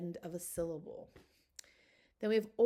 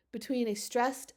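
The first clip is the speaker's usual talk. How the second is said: normal talking voice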